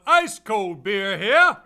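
slow, very loud drawn-out hawker call; stadium vendor hawking. Shown as a demonstration. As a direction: stretch it out across the stadium. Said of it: "I just had the typical one".

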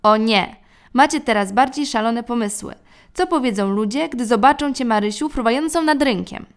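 loud, exasperated father's protest. A narrator's reading. Father objects to the family flying, loud. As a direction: scold them as a fussy dad.

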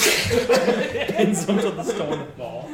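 high, loud, drawn-out words through laughter; laughing. Real, not acted. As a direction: barely get it out for laughing. Topping the joke amid laughter.

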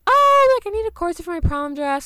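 acted-out high, loud opener easing down; high-voiced reply. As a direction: imitate your own perky, high-pitched answer. Retells own reply to shop lady.

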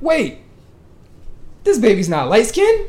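high, loud laughing shock; shocked and cracking up; laughing disbelief. Real, not acted. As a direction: burst out in amused disbelief. Mock disbelief at baby.

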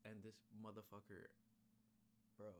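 usual speaking voice

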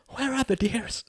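a frightened grandmother asking; old woman's worry. Acted. Old grandmother asking after the children, frail and high.

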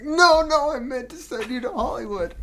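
high, squeaky put-on voice for the judge; a hammed-up panicky judge; mock Simon voice. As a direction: blurt it out as a flustered judge. Retelling Simon's reaction, mock voice.

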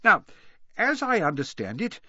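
put-on jolly old headmaster's speech; cheerful. A narrator's reading. Dumbledore welcoming pupils to the year-end feast, cheerfully. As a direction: address the hall with light good cheer. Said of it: "Dumbledore said cheerfully".

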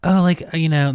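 lekko przerysowany, wysoki głos bogaczy; mock rich people. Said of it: "these people are so rich".

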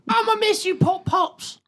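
high squeaky farewell voice; squeaky mock grief. Mock farewell to dead grandpa.

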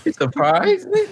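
very high, incredulous question. Acted. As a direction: squeal it up high as disbelief. Incredulous echo of surprise.

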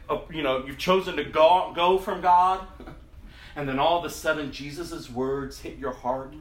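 ordinary talk

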